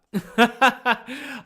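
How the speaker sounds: sudden high, loud laugh; loud laugh burst. Real, not acted. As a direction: break the heavy mood with a real laugh. Bursts out laughing after story.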